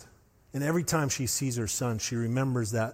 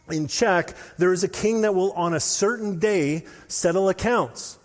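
rising preaching; 5 semitones higher, 4 dB louder than usual. Acted. Preaching at full force.

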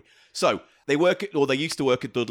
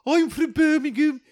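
high, loud bad Brummie; slow, squeaky attempt at a Birmingham accent; badly faked Brummie; 14 semitones higher, 4 dB louder than usual. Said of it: "Please don't do the accent".

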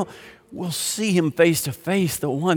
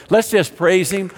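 louder and climbing higher into fervent praise; call to praise; 6 semitones higher, 4 dB louder than usual. Acted. Pastor leading the congregation to praise Jesus, louder and higher. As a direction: lift the room into worship.

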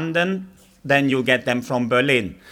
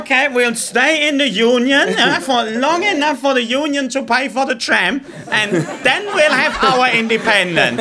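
much higher, sly mocking voice; mocking Scots; 10 semitones higher than usual. Comedian voicing Scottish independence plans, mocking and higher. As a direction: send up the Scots' scheming plan. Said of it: "It's like".

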